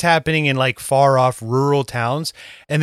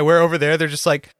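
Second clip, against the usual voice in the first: ordinary talk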